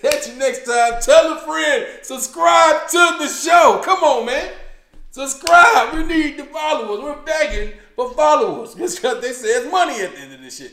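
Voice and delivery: loud, high, shouting outro; hype shout. Real, not acted. Host closing the show, hyping listeners to subscribe, loud and high. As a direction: pump up the sign-off and beg for subscribers.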